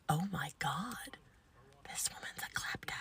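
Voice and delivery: hushed, astonished aside; whispered aside. Retold or imitated. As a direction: whisper it to yourself in disbelief. Aside on patient's stolen razor.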